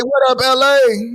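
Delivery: calling out. Real, not acted. Calling out to viewers.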